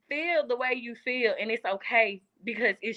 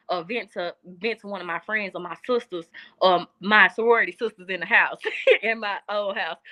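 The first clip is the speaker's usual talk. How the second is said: normal talking voice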